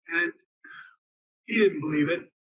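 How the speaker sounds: normal talking voice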